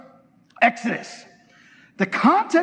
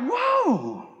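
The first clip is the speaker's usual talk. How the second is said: high, drawn-out swoop of amazement; comically stunned reaction; amazed whoa; 9 semitones higher, 3 dB quieter than usual. Acted.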